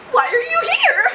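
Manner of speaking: a sunny doctor, the opposite of the bored nurse; mock-cheerful doctor; bubbly doctor. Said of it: "the antithesis of the nurse".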